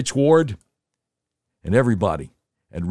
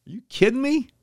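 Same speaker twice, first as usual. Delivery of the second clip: incredulous; 13 semitones higher than usual. Real, not acted. Disbelief at the date.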